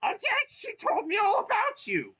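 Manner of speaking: very high, loud squeaky thanks; grateful Toad. Acted.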